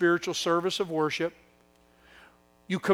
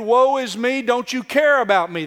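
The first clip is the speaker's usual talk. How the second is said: high, loud whiny complaint; mock the whining Israelites, high and loud; 7 semitones higher, 8 dB louder than usual. Preacher mocking Israel's whining to God, high loud whine. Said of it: "That's the children of Israel's whining".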